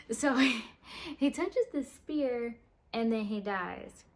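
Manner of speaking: ordinary delivery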